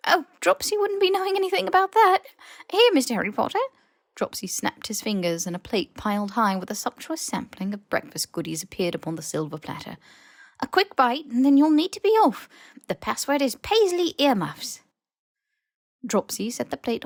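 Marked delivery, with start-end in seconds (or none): 0.1-3.6 high, loud, eager elf voice; squeaky house elf. Acted. House elf dodging Harry's question, high and squeaky. 10.6-14.8 high, loud, eager elf voice; squeaky house elf. Acted. House elf dodging Harry's question, high and squeaky.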